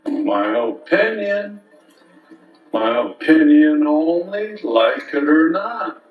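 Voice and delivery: slow, loud, drawn-out proclamation; town crier. Acted.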